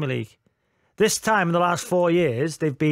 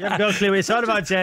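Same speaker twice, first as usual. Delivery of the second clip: laughing; much faster than usual. Real, not acted. Teasing co-host, laughing.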